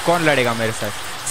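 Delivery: normal talking voice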